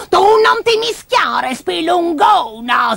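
high, loud, drawn-out scolding; stretched, screechy old-lady rebuke; grandma's outburst. Acted. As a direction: snap at the meddler as a cranky granny, shouting high. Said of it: "sbottò la nonnina".

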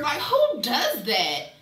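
slow, loud diva indignation; drawn-out, sassy outrage. Real, not acted. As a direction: stretch it out with diva attitude. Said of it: "I had a [name] moment".

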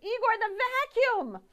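high loud cry for the vacuum; urgent shout. Acted. Victoria shouting to Igor for the vacuum. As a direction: yell it in a panic. Panicked order.

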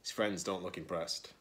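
ordinary delivery, nothing marked

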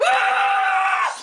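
high, loud yelp of pain; pained scream. Acted. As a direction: scream as if suddenly hurt. Sudden pain, yelling out.

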